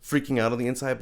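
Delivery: usual speaking voice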